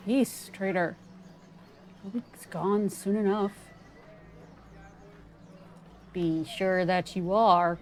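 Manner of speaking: a hint of a hostile villager with a faint accidental accent; casual, slightly nasal voice. Player voicing a suspicious moon villager, casual and nasal. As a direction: keep it casual and slightly nasal, cool toward the stranger. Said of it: "my accents are terrible".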